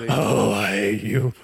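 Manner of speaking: low, slow hammed-up hate; slow mock loathing. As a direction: drag out the grudge. Bob's final line.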